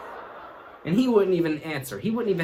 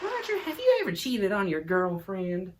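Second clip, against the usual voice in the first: psychologist question; 5 semitones higher than usual. Retold or imitated. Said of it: "Such as".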